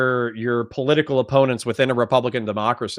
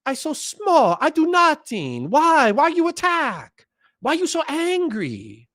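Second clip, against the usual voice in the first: very high, slow cutesy mock Japanese accent; 13 semitones higher, slower than usual. Mimicking opponents playing small, mocking Japanese-accented voice. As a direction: whine helplessly in a caricatured Japanese accent. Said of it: "I don't know why it became Japanese".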